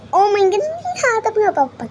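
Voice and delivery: high squealing gush. Real, not acted. Reacting to item, high squeal.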